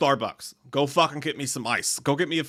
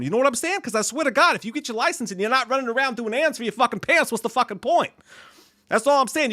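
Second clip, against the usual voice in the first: put-on higher, brassy tough-guy pep talk to a teen; Boston parole officer; 7 semitones higher than usual. To a fan's son on his birthday, tough Boston pep talk. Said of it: "You sound like every parole officer in Boston".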